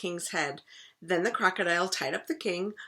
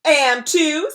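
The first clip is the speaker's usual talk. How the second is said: insisting; 9 semitones higher, 12 dB louder than usual. Acted. Crocodile insisting.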